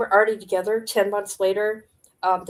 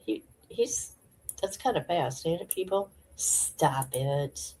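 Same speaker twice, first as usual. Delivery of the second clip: ordinary talk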